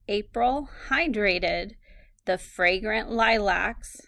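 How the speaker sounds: slow, measured dictation in short phrases. Shown as a demonstration. Teacher giving students a sentence to write, slow dictation. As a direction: read it out slowly, phrase by phrase.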